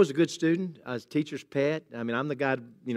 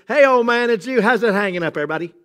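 louder, higher mock-cocky greeting to God; mock casual greeting; 5 semitones higher, 5 dB louder than usual. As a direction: toss it off like a flippant buddy. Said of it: "I don't think that would be appropriate".

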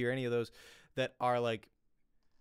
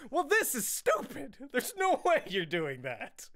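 high, slow mocking voice with a laugh; dismissive jury; 11 semitones higher, slower than usual. Jury laughing off the lawsuit, mock high scoffing voice. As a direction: play a scoffing juror, pitched way up. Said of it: "they'd be like".

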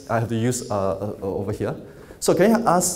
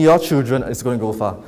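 ordinary talk, nothing marked

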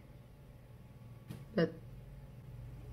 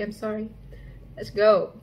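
normal talking voice

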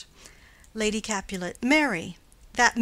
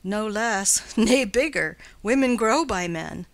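livelier, louder Nurse's retort; 5 dB louder than usual. Acted. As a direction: cut in loudly as the bawdy Nurse.

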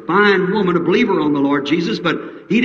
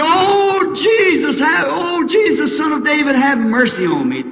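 crying out; 9 semitones higher than usual. Retold or imitated. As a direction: beg him to hear you over the noise. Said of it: "He cried".

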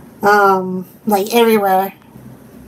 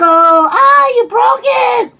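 high, loud mock shriek; shocked outcry; 9 semitones higher, 4 dB louder, much slower than usual. Streamer voicing a viewer seeing the break, loud shocked cry. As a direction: exclaim it loudly, pitched way up. Reading viewer's exclamation aloud.

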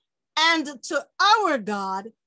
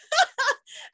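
teary laugh-cry; 13 semitones higher than usual. Real, not acted. Breaks into crying laugh.

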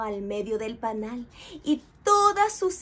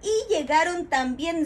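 usual speaking voice

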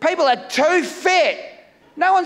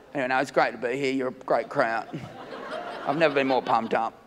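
much lower and softer, rushed and deadpan; flat fake enthusiasm; 11 semitones lower, 8 dB quieter than usual. Comic to the crowd, claiming he's pumped up in a sarcastic deadpan mumble. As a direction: mumble the hype like you don't mean it.

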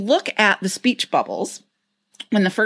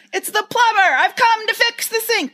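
two exclamation points. Retold or imitated. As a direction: shout it out, fed up. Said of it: "two exclamation points at the end".

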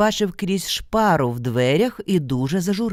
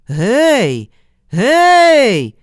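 long drawn-out hollers, second much higher and louder; answering shout; 7 semitones higher, 10 dB louder than usual. Acted. Mykola answering the unseen caller, long holler. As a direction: call back into the dark forest. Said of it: "Микола знову".

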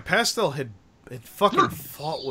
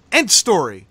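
emphatic; 5 semitones higher, 13 dB louder than usual. Real, not acted. Emphatic conclusion.

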